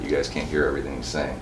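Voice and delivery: normal talking voice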